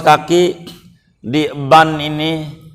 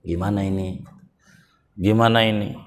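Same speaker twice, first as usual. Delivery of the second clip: hushed, low murmur among the gang; mock whispering; 7 semitones lower, 7 dB quieter, faster than usual. Robber's men confer on their boss's offer, mock whispering. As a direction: lower and soften it, as if conferring privately. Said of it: "Itu bisik-bisik".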